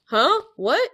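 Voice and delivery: startled hero yelp; surprised character. Acted. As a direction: jolt in surprise.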